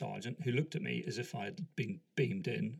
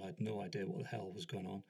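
usual speaking voice

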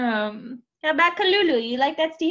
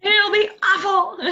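mock kid threat; 9 semitones higher than usual. Mocking the kid's threat.

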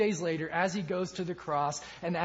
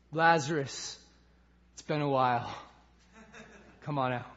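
casual greeting; 6 semitones lower, 4 dB quieter than usual. Acted. Acting Jesus casually greeting dead Lazarus.

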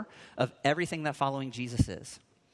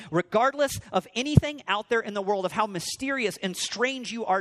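higher, impassioned declaration; acted passionate vow, pitched up and insistent; fervent resolve; 6 semitones higher, 3 dB louder than usual. Voicing Peter's bold resolve to Jesus.

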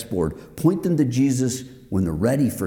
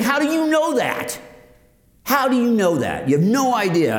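indignant, climbing to a high-pitched challenge; mock-furious tirade, voice pushed up; furious retort; 6 semitones higher than usual. Man fuming at glib comfort after his building burnt, furious. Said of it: "I was so stinking mad".

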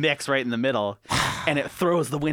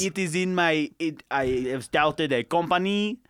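mock French accent; slower than usual. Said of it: "Jean-Pierre".

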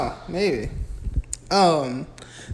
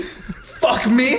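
high, incredulous rising question; mock indignation; 6 semitones higher than usual. Principal challenging a cursing student, mock-indignant disbelief. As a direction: imitate an affronted principal, voice pitched up.

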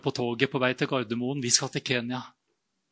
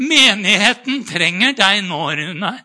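louder, higher, solemn pious call; mock religious voice; 5 semitones higher, 8 dB louder, slower than usual. Mocking the pious voice calling Rune. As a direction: ham up the holy calling. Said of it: "det kommer så religiøst".

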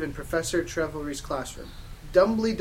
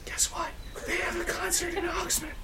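hushed secret-sharing whisper; 13 semitones higher, 4 dB quieter than usual. Acted. Satan telling a girl about a concert, whispered. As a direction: whisper it like exciting news. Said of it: "Satan whispered".

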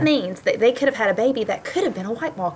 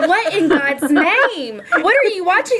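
slow, high mock outrage; shocked husband; 11 semitones higher, 4 dB louder, slower than usual. Husband Rod reacting to the Red Wedding, mock shocked. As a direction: imitate a stunned husband, drawn out.

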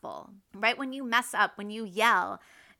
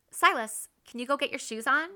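higher, lilting polite request; loving, patient; 4 semitones higher, faster than usual. Shown as a demonstration. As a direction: ask your son kindly, voice rising to a question. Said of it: "second way conveys love and patience".

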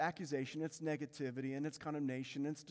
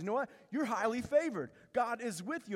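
lightly voiced angel, higher and emphatic; angel's announcement; 7 semitones higher, 4 dB louder than usual. Angel tells Mary she is favored, emphatic announcement. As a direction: proclaim it a little louder and higher. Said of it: "the angel of the Lord comes from God and says".

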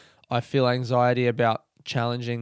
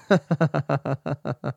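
short amused laugh. Real, not acted. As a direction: laugh at the bit. Laughs at own cute voice.

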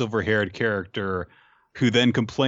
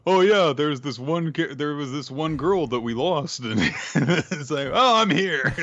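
mocking cartoon voice; 6 semitones higher than usual. Mocking manga characters.

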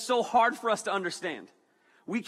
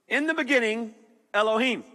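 higher, louder "In the beginning, Elohim" in a grand proclaiming voice; ancient voice; 5 semitones higher, 4 dB louder than usual. Shown as a demonstration. Preacher declaring the Hebrew opening line, grand and proclaiming. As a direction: intone "Elohim" like an ancient oracle. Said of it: "that's where I went".

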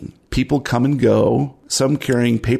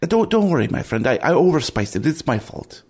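higher-pitched cook consoling a sick friend; Cajo, reassuring; 6 semitones higher than usual. Acted. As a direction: reassure him gently in character, pitched up. Game master plays Cajo.

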